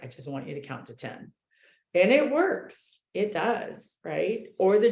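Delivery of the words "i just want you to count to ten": much softer, quicker, soothing a friend; a hint of the calm big sister, hushed; quiet, quick reassurance. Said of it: "she's like".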